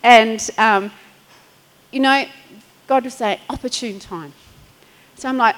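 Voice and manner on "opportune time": gentle hushed reminder; quiet divine voice. Retold or imitated. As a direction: murmur it like a still small voice. God was saying.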